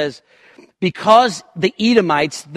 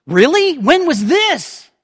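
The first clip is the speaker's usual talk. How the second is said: much higher and louder, slow sarcastic disbelief; hammed-up mock incredulity; incredulous scoff; 11 semitones higher, 6 dB louder, slower than usual. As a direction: play a scoffer who can't believe it. Israel scoffs at God.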